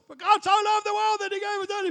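high, loud shouted verse; yelled out. Retold or imitated. Wigglesworth shouting through a stranger's mail slot, high loud yell. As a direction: belt the verse into the letterbox. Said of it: "yells out the top of his voice".

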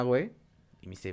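normal talking voice

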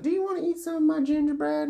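very high, put-on old lady; old woman voice. Old woman greets three kids, shrieky high caricature. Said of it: "she's like".

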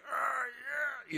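put-on loud, high drunken cheer; drunk happy shout. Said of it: "And you were like".

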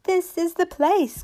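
high, slow announcement; excited cry. A narrator's reading. Raggedy Ann at the pantry door, excited high cry. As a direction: cry out the discovery, drawing it out. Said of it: "cried Raggedy Ann".

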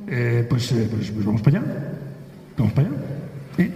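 low, hesitant pus-para-allá answer; vague clueless man. Retold or imitated. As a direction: drop the voice low and unsure.

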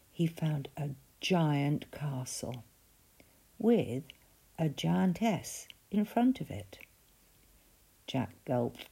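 plain reading voice

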